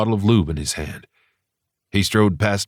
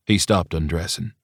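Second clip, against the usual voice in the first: usual speaking voice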